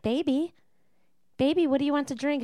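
mock doting boyfriend cooing; cooing baby talk. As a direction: coo like talking to a toddler. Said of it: "And he was like".

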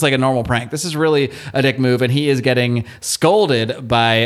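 normal talking voice

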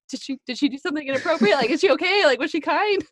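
high, loud, hurried worried voice with a laugh; a nervous father fearing the worst, fast and high; worried dad. Retold or imitated. Dad asks strangers if his daughter misbehaved, high and anxious. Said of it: "And he's like".

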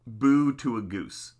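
usual speaking voice

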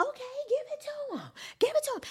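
very high, loud egging-on; hyped cheering. Retold or imitated. Fan cheering Mario on, high excited shout.

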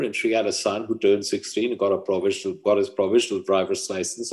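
ordinary delivery

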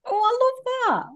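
very high, delighted exclamation; real delight. Real, not acted. Host reacting to guest's closing advice, delighted. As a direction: gush with sudden delight, voice leaping up.

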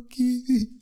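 hammed-up hysterical deathbed pleading; hysterical crying. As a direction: wail a last plea through mock sobs. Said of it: "I'm crying now. I'm hysterical".

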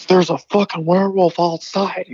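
high, alarmed mock panic; imitating panicked brother. Retelling brother bursting in from the werewolf, panicked. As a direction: blurt it out high, like a frightened brother.

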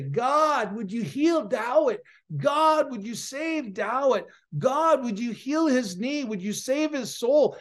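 pleading intercession, acted out; earnest prayer. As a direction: retell a fervent prayer, pitch lifted. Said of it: "I began to cry out to God".

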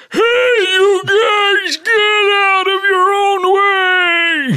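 put-on high, slow, loud old man shout; old man yelling. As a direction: holler like a cranky old man. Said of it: "I'm gonna sound like this".

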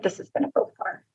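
soft unvoiced whisper; hushed prophecy. Editor promising the book will go far, soft whisper. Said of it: "She's like".

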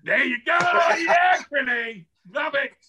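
real excited cheer for his mate; delighted cheer. Real, not acted. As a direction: roar approval at him. Cheering mate's insult.

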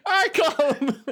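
laughing prospector. Acted. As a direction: prospector line cracking up with laughter. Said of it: "You are a prospector now".

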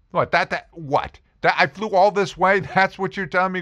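high, sputtering, incredulous protest; mock outrage. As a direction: protest as if cheated after a long journey. Acted seeker protests to guru.

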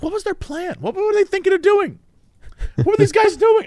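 high-pitched incredulous questioning; baffled exasperation. Retold or imitated. Teller questioning the bystanders' plan, in high-pitched mock exasperation. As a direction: squeal the questions in comic disbelief.

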